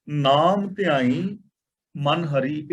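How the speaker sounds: ਨਾਮ ਧਿਆਈ drawn out slowly, a pause, then ਮਨ ਹਰੀ; slow chant with a break before ਮਨ ਹਰੀ; paused recitation. Shown as a demonstration. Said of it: "ਇੱਥੇ ਵਿਸਰਾਮ ਲਗਾਵਾਂਗੇ".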